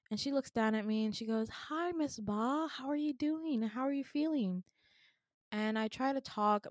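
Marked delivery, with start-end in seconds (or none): 1.5-4.6 a hint of a sweet kind-faced doctor. Doctor greeting the drowsy patient, a lightly higher gentle voice.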